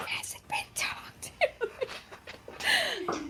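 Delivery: whispering under her breath while counting; whispered to self. Real, not acted. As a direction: breathe it out, almost to yourself.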